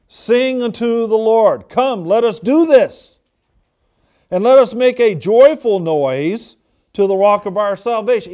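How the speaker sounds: a preacher's full-voiced reading of a psalm; scripture read with fervour. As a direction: proclaim the verse to the congregation.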